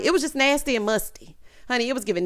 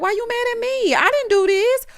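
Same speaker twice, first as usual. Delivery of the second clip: much higher whiny protest; squeaky put-on whiny daughter; 14 semitones higher, 4 dB louder than usual. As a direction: protest like a defensive daughter, pitched up. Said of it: "[name]'s like".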